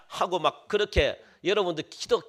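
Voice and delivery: normal talking voice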